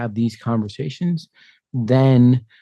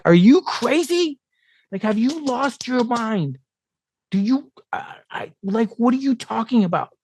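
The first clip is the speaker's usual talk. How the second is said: mimicking a stunned mother's disbelief; shocked mother; 11 semitones higher than usual. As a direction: play the horrified mother. Mother shocked at son's plan.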